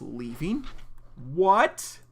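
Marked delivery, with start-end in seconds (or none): 1.3-2.0 loud shocked outburst; shocked what. Real, not acted. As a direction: blurt it out in shock. Reacting to shocking news.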